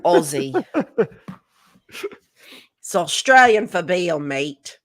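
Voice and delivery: loud, hammed-up mock Australian accent; a terrible Aussie ad voice; bad Australian accent. Host hamming an Aussie beer slogan for the guest, loud mock Australian. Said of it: "Sorry you had to listen to my terrible accent".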